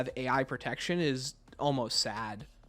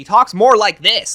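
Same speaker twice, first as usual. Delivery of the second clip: pitched way up, loud and slow, mocking the actor; mocking Weaver's voice; 6 semitones higher, 14 dB louder, slower than usual. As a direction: push the voice upward like a bad voice actor. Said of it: "like he's shooting upwards with his voice".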